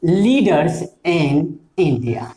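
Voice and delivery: slow, spaced out dictation. Shown as a demonstration. Teacher to class, dictating the sentence end slowly. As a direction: let each word land for the notebook.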